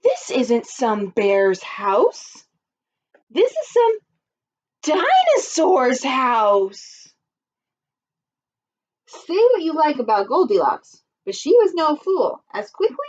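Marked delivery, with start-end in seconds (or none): 0.0-7.1 alarmed realization. Acted. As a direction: spell out the discovery slowly, rising in alarm.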